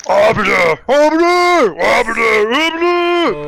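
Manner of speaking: repeated high shout; yelling. Retold or imitated. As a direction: bellow the word Arbiter. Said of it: "them yelling it back and forth".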